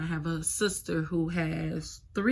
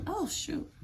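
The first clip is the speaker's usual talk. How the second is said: dismayed aside; 11 semitones higher, 4 dB quieter than usual. Retold or imitated. Realizing the worry.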